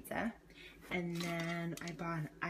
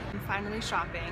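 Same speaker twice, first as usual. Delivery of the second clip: ordinary delivery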